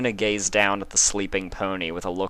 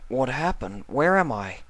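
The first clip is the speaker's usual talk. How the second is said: put-on higher, bewildered young voice; Spike, confused young dragon; 7 semitones higher than usual. Spike waking in Luna's chamber, confused. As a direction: wake up lost and unsure.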